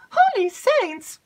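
high, loud eager maid's voice; breathless excited maid. Acted. Maid rushes in to tell Emily news, excited.

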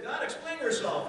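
high, loud outcry at God; indignant demand. Retold or imitated. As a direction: throw the demand up at heaven. Job demanding answers from God.

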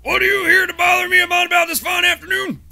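high, loud barking sergeant voice; shouting drill sergeant. Acted. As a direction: bellow at the recruit like a drill instructor. Drill sergeant answering recruit.

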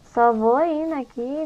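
ordinary talk

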